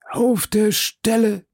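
slow, high, hollow reply; drawn-out, distant-sounding assent; man behind door. A narrator's reading. Athos answering from the cellar.

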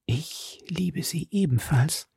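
slow breathy whisper of love. A narrator's reading. Milady confessing love to the count in a slow whisper. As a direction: breathe it intimately, slowly. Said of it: "mit ihrer sanftesten Stimme".

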